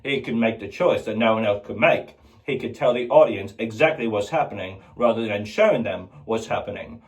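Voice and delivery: usual speaking voice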